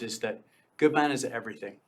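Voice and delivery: ordinary delivery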